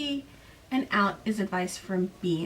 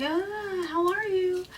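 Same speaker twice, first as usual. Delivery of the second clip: much higher, chirpy mom hello; sing-song mom greeting; 10 semitones higher than usual. Retold or imitated. Mom voiced in recap.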